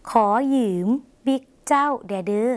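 request with แดเดอ held long at the end; drawn-out final word. Shown as a demonstration. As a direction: stretch out แดเดอ to ask for a favour. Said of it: "ต้องลากเสียงยาวสักหน่อยนะคะ".

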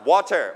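water with a crisp aspirated t; water, the t clearly released; pronunciation variant. Shown as a demonstration. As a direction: say water as "WAW-ter", t fully sounded. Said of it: "ออกเสียงได้หลายแบบมาก".

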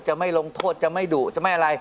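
ordinary talk

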